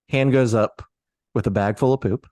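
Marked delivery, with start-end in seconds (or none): none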